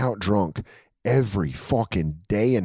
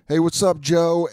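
higher, louder, breezy mock greeting; hammed-up cheery hello; imitating Patrice; 9 semitones higher, 4 dB louder than usual. Patrice to Joe in the hallway, a mock breezy greeting. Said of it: "And he goes".

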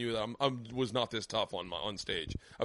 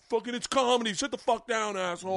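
mock crowd yell; 9 semitones higher than usual. Crowd yelling at heckler.

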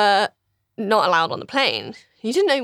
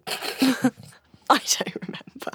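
unvoiced aside under the breath; real whispered aside; whispered confession; 3 semitones higher, 4 dB quieter, slower than usual. Real, not acted. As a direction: whisper it as a sheepish admission. Admits forgetting the story.

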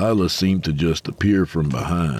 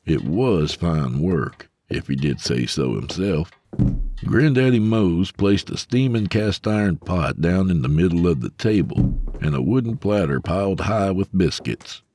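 ordinary delivery, nothing marked

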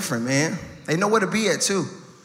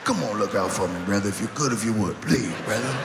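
much lower mumbled hustler plea; crackhead mumble; 10 semitones lower than usual. Retold or imitated. Comic imitating a crackhead begging in a deep mumble. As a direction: chew the words like rug, low. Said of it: "Don't they talk that?".

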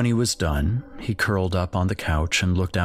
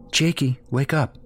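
mother waking son; 7 semitones higher than usual. Acted.

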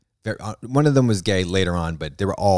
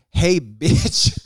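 sassy greeting; 6 semitones higher, 3 dB louder than usual. Retold or imitated. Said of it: "I'm like".

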